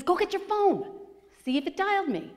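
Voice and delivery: excited quoted friend, high pitched; excited urging. Retold or imitated. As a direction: push her to check, eager. Gabe urging her, excited.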